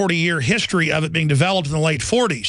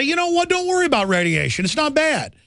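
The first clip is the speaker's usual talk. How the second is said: high-pitched breezy dismissal; mock reassurance; 10 semitones higher than usual. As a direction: mimic officials airily waving away a danger. Mimics officials brushing off radiation.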